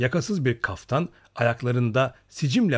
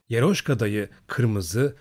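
usual speaking voice

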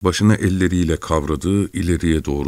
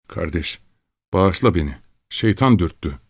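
ordinary delivery

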